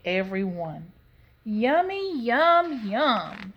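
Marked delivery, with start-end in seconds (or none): 1.4-3.5 delighted yum. Acted. Leola eats every nut.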